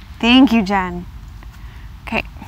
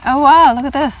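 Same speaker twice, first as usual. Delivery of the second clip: bright, louder wow of delight; amazed wow; 5 semitones higher, 5 dB louder than usual. Real, not acted. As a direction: gasp out the wow as the box opens. Amazed opening a package.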